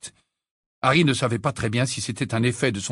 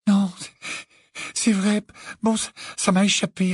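higher, halting, mildly upset voice; tearful upset; 8 semitones higher than usual. A narrator's reading. Hermione admitting it slipped out, upset. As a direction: falter as if wiping away tears. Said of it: "répondit-elle en s'essuyant les yeux avec colère".

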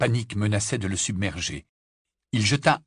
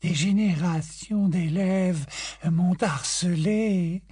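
slow, high, disdainful drawl; 8 semitones higher, much slower than usual. A narrator's reading. Grey Lady scorning Harry's request, slow and disdainful. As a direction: look down on him. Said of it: "avec dédain".

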